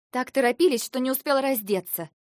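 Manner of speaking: loud, high angry jab; angry retort. A narrator's reading. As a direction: throw it back furiously. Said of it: "гневно бросила я".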